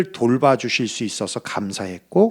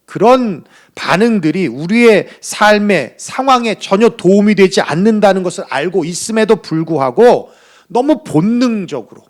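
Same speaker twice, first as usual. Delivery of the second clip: ordinary talk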